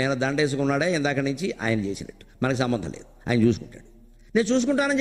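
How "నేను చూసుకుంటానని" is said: god's promise. Retold or imitated. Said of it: "చెప్పాడు ఆయన".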